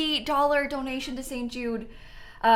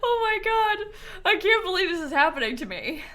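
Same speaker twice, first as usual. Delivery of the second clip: high, tearful laughing disbelief; nearly crying with happy shock; overwhelmed joy; 7 semitones higher than usual. Real, not acted. Streamer overwhelmed by what is happening to her, tearful joy.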